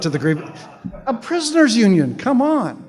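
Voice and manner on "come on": normal talking voice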